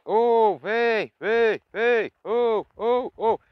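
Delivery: high, loud drawn-out call to the horses. Shown as a demonstration. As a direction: call out like grandpa calling the herd. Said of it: "Então ele usava um tom de voz pro cavalo vir até ele". (chamando os cavalos, alto e agudo, como o avô fazia)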